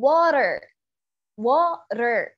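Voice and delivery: drawn-out "water" as "waa-rer"; tapped R. Shown as a demonstration. Teacher asks class to listen, slow tapped R.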